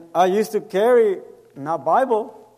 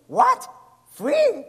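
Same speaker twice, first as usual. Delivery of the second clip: much higher, startled what, free; surprised. Retold or imitated. Stranger amazed the tacos are free, high surprised squeak. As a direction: imitate someone who can't believe it's free.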